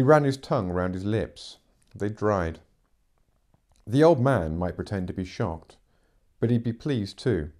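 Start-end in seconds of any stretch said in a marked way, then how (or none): none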